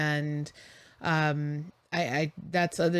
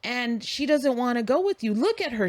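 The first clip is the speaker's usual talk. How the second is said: heated retort; 7 semitones higher than usual. Retold or imitated. Arguing with the stranger at the curb, heated and raised. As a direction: insist he back off.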